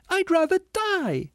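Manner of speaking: very high, slow wail; anguished ghost. A narrator's reading. Ghost refusing to come out, anguished wail. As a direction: cry out in high-pitched despair.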